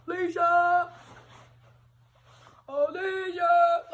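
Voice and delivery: loud, high call of a name; screaming her name. Retold or imitated. Boyfriend searching the dance room, shouting her name loud and slow. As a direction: yell the name across the room, drawn out. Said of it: "he come in screaming my name".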